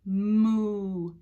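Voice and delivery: long drawn-out moo; cow moo. Shown as a demonstration. Teacher showing children what a cow says, long moo. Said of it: "What's the cow say?".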